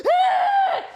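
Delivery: very high shrieking fan scream; fangirl scream. Retold or imitated. Starstruck fan screaming at her idol, high shriek. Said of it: "I just screamed at the top of my lungs".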